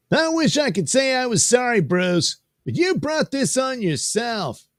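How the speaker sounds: high-pitched comic-book antagonist; comic character confronting Bruce. Acted. Addressing Bruce in a confrontation, high put-on character voice.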